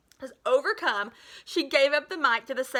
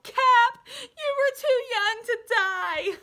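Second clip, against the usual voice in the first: high, loud, slow wailing howl; hammed-up grief, arms raised; 13 semitones higher, 6 dB louder, much slower than usual. A narrator's reading. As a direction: melodramatic anguished wail. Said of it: "and howled".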